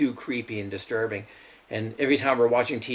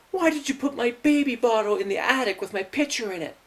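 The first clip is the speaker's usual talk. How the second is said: higher, sharper voice for the accusing sister; 9 semitones higher than usual. Retold or imitated. Sister accusing her brother over the baby bottle, mock shrill. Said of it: "And my sister goes".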